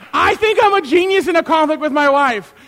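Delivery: loud, high, emphatic declaration; comically insistent bragging; mock boast. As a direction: proclaim it over the top. Repeats his comic boast.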